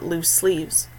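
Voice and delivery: usual speaking voice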